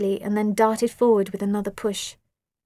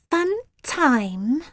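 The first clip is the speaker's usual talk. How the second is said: a hint of a menacing purr; 5 semitones higher than usual. A narrator's reading. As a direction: purr it with a sly grin. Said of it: "she purred".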